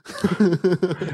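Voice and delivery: snorting laughs. Real, not acted. Laughs at own joke about laughing.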